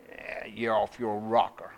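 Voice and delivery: low, slow mocking brush-off; imitated doubter's low drawl; dismissive scoff. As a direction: wave him off as crazy, low and dragging. Voicing people who dismissed him.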